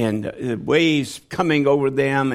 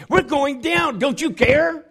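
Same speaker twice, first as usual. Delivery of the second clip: frantic cry, climbing much higher at the end; panicked plea; 5 semitones higher than usual. Retold or imitated. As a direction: plead to wake him as the boat sinks. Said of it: "Pete goes up".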